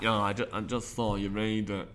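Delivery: low, soft offhand retort; imitated spectator. Spectator tells the magician she saw it, low and soft. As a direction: drop low and quiet, mimicking her unimpressed reply. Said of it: "she was like".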